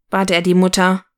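usual speaking voice

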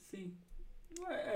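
ordinary delivery

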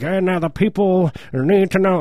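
high, declaiming orator voice; hammed-up statesman impression; Roosevelt. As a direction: proclaim it like an old presidential address. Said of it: "And he talks like this".

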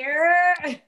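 high, stretched-out imitated holler; everybody's long greeting whoop; drawn-out call. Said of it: "Everybody like".

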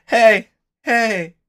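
a high, pleading voice begging her not to die; pleading dragon voice. Retold or imitated. Retelling how the dragon mourned, in a high pleading voice. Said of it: "When it was like the".

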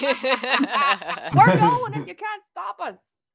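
slow, loud taunt through laughter; Shane voice. Acted. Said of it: "That's Shane again".

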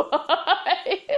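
high, laughing question; real giggling fit. Real, not acted. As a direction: squeak it out mid-giggle.

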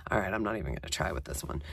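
fast resigned whisper under her breath; whispered aside. Real, not acted. Giving up on her own stubbornness, in a whisper.

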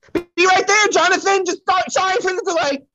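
high, loud call to the other room; calling offstage. Acted. Psychic calls to waiting John, high and loud. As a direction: shout an apology toward John.

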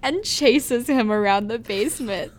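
high, loud, cracking up mid-story; laughing. Real, not acted. Telling co-host the flaming spider ending, laughing. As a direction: fight through real laughter to get it out.

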